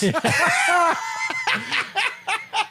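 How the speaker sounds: very high, real peals of laughter; shrieking laughter. Real, not acted. Host cracking up at the post office riff, helpless laughter. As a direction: lose it completely.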